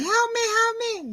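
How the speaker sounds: high mock pleading child voice; fake kid cry for help. Boy tricking his sitter, a high fake plea. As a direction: squeak it like a faking little boy. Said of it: "And he's like".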